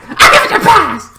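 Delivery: very high "I give it a pass"; hammed-up reluctant okay; mock grudging approval. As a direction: squeak out the verdict. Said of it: "at the ending I'm like".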